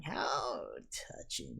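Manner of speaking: low, slow, soft hiss; slow soft sneering hiss. A narrator's reading. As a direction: hiss it with cold mockery. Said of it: "he hissed".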